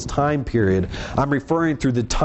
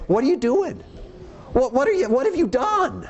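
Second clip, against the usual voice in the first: mock indignant challenge; 11 semitones higher than usual. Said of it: "No one has the right to say".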